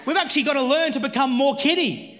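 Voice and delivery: rally the congregation to faith; full-force preaching. Acted.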